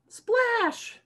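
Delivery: high, bright cry of splash; splash sound. Shown as a demonstration. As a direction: shout it like a splash for the kids. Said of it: "Can everybody splash?".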